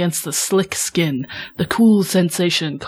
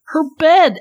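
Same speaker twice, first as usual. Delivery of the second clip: higher, louder indignant cry; outraged; 9 semitones higher, 6 dB louder than usual. A narrator's reading. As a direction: spit it out in disbelief. Outraged narration of Beau's horror.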